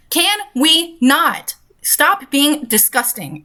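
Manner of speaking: disgusted plea. Real, not acted. Reacting to story with disgust.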